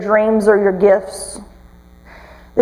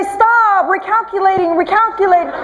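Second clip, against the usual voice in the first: higher mock GPS, laughing at the end; hammed-up robotic recalculating; 6 semitones higher than usual. Preacher mimics a third GPS, mock recalculating voice. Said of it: "The other one would say".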